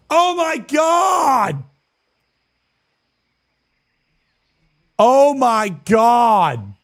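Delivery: slow, drawn-out high shout of disbelief; excited shout. Real, not acted. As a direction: yell it out in pure shock. Shocked to see old viewer.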